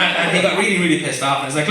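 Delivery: usual speaking voice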